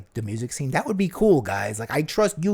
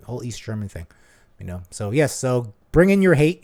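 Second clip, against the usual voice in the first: ordinary talk, nothing marked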